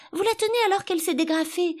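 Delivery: very high, fast warning; alarmed cry. A narrator's reading. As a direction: blurt it out in alarm. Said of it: "s'écria Alice".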